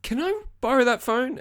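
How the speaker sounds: high, put-on character voice; hesitant, high-pitched impression of asking; put-on timid request. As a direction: ask haltingly in a high mock voice. Impersonates Kyle asking for phone.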